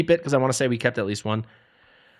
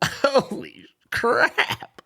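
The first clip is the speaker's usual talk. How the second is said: much higher, slow breathy laugh-whisper; 11 semitones higher, much slower than usual. Real, not acted. Gamer seeing worst gear, laughing whisper. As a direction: let disbelief crack into a laugh.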